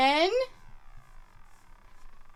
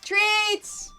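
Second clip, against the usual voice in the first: treats; 10 semitones higher, 3 dB louder than usual. Real, not acted. Calling dogs for treats.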